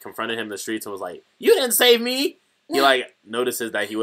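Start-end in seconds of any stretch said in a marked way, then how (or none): 1.4-4.0 indignant retold outburst. Host mimics rival confronting the hero in the street, indignant. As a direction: protest it like an affronted rival, pitched way up.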